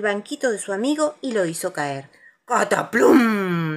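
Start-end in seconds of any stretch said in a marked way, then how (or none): 2.5-3.7 loud, drawn-out crashing word; imitated crash. A narrator's reading. Narrator as Pooh falls off his stool, crashing. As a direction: boom it out slowly like a tumble.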